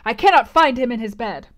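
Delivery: loud, urgent report; crying out. Acted. Colonel reports his host missing, loud cry. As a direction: call it out to the whole gallery. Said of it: "cried Colonel Dent".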